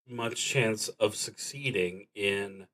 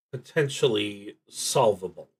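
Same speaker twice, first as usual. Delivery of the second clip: ordinary talk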